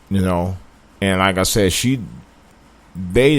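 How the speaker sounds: ordinary delivery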